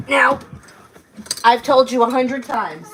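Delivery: loud, slow, angry scolding yell. Real, not acted. Mother yelling at her daughter over the ruined shoes, angry yell.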